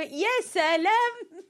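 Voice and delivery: high, loud, slow sarcastic "يا سلام" with a laugh; sarcastic laughing wow; mock sarcastic wow. Real, not acted. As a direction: drag out "يا سلام" in mocking disbelief. Mocking a listener's comment.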